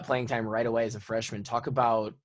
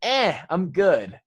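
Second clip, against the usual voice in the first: slow, louder, higher lazy brush-off; mock dismissive; 7 semitones higher, 6 dB louder, much slower than usual. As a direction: drag it out like you couldn't care less. Imitating coach dismissing tape.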